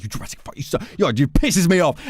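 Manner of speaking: high, loud mock yell; hammed-up fury, spitting the insults; mock furious rant. Retelling the meme, woman screams back at a man. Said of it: "and she's like".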